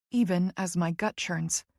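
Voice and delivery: ordinary delivery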